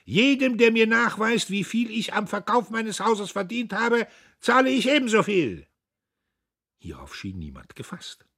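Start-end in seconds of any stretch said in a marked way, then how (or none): 0.0-5.7 loud, much higher roar; loud, high bellow. A narrator's reading. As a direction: hammer the offer into the hall. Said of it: "brüllte Diederich".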